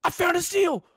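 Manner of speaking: high, urgent announcement; excited shout. Real, not acted. Gamer finding steel mid-chase, excited shout. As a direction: yell out the sudden find. Excited find while fleeing.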